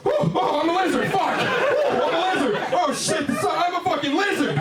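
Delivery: very high, screeching freaked-out lizard; panicked lizard. Retold or imitated. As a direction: squeal like a stoned lizard in panic. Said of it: "He's like".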